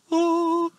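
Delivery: high, sustained "oh"; exclaimed accent point. Shown as a demonstration. Preacher marking the wonder of forgiveness, high drawn-out oh. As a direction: hold a long "oh" way up high. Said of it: "that's my accent point".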